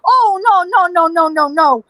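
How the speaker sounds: mock-alarmed run of no's, starting high; dismayed panic. Watching men shoot at each other, acted dismay.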